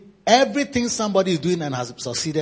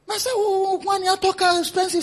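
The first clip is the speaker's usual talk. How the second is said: high mocking voice of a fault-finder; a high mock of a grumbling member; mocking church member; 11 semitones higher than usual. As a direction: sneer like a petty critic. Church member mocking pastor's car.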